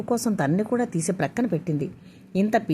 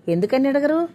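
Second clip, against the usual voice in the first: higher, louder defiant challenge; 6 semitones higher, 4 dB louder than usual. A narrator's reading. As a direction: throw the question back defiantly. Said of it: "అన్నాడు తలెగరేస్తూ".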